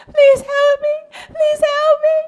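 high-pitched, tearful begging; desperate plea. Acted. Runaway girl begs at Amy's door, desperate plea. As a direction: act out a frantic cry for help.

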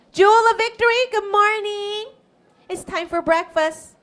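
high, sing-out morning call; cheery calling. Acted. Amy calls the girl to breakfast, cheery calling. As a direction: call out to wake a child.

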